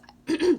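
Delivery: sharp throat clear. Real, not acted. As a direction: clear your throat loudly. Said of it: "Sorry, I'm losing my voice".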